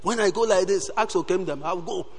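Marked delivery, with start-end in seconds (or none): none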